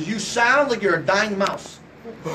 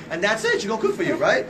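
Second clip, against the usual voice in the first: ordinary delivery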